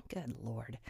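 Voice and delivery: weary whispered exclamation; whispered aside. Real, not acted. Vlogger muttering to viewers about hindsight, in a whisper. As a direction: breathe it out under your breath in disbelief.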